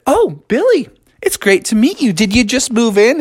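high, chirpy beaver voice; friendly welcome. A narrator's reading. Beavers meet Billy for the first time, friendly welcome.

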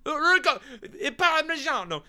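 over-the-top Italian-American food shout; mock Italian exclamation, high and loud; mock Italian accent. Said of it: "not how you say any of that stuff".

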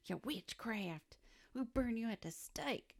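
put-on Southern accent. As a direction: threaten like a hammed-up Southern townsperson. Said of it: "did that in the Southern accent".

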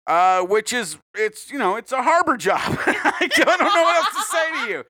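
ordinary delivery

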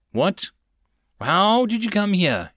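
indignant elf interrogator; stern demand. A narrator's reading. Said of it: "she demanded in a stern tone".

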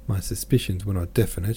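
ordinary delivery, nothing marked

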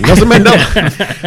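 high laughing restart. Real, not acted. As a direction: laugh it out loudly. Laughing, back to topic.

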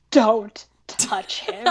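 very slow, half-whispered warning; mock seething anger. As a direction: imitate a jealous woman, spacing out each word. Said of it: "so mad".

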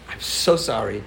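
put-on a touch of a sheepish kid apologizing; meek apology. Friend apologizes on school bus.

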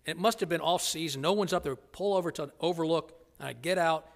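usual speaking voice

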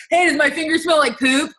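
dumb guy in a video. Retold or imitated. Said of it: "this guy's like".

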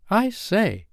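put-on high-pitched boyish exclamation; boy struck by an idea; excited cry. A narrator's reading. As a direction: pipe up suddenly, high. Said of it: "cried John".

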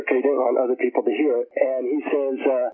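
ordinary talk, nothing marked

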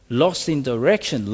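ordinary delivery

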